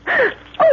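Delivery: high startled gasp; sharp gasp. Acted. Lorna seeing the python, startled gasp. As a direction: gasp at the sight.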